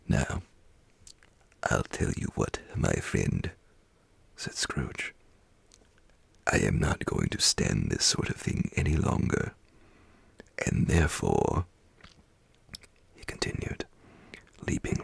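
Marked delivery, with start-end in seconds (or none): 0.1-11.7 lower, feigned menace; a hint of stern old Scrooge; mock-threatening boss. A narrator's reading. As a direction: pretend to scold, lower.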